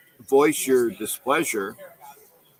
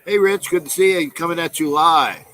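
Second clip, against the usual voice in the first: loud cheery greeting; 6 semitones higher, 7 dB louder than usual. Real, not acted. Greeting a viewer on stream.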